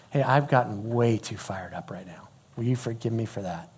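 soft, low, half-whispered confession; quiet apology. Retold or imitated. Dad asking son's forgiveness, quiet apology. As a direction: own up gently in a hushed voice.

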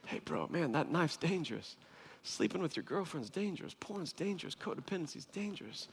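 soft, hushed word of caution; gentle caution. Acted. Group member quietly cautions a friend after confession, gentle and hushed. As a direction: quietly warn a buddy.

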